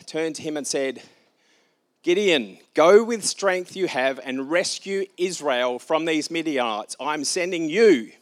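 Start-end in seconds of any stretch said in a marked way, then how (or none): 2.0-8.1 slow, loud and higher, each word weighted; commanding Lord. Acted. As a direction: send him out with divine authority. The Lord commissions Gideon.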